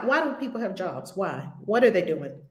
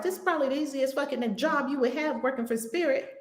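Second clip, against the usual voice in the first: normal talking voice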